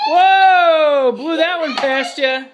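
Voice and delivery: loud, high surprised whoa; excited shout. Real, not acted. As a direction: shout it out in delight. Dad loses air hockey goal.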